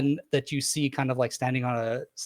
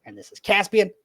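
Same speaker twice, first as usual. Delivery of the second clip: higher, louder proud dad voice; proud introduction; 4 semitones higher, 5 dB louder than usual. Acted. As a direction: present them with a proud flourish. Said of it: "he goes".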